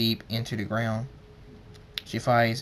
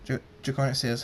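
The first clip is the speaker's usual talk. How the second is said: plain reading voice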